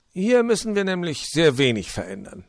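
high, loud, jolly fox voice; high-pitched welcoming fox; cheerful fox. Acted. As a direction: play the hospitable old fox, beaming. Fox voice held.

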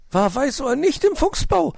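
high, fussing magpie voice; a worried bird asking at the table. Acted. Frau Elster fragt Onkel Uhu besorgt, hohe Elsternstimme.

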